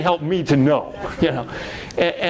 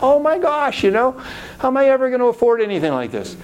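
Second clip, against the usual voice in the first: dismay; 7 semitones higher, faster than usual. Retold or imitated. Seeing the expensive ticket.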